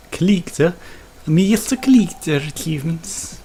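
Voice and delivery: mock Star Trek Russian officer; Chekhov Russian accent. Reading an achievement name in a put-on Russian accent. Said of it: "God, what was that accent?".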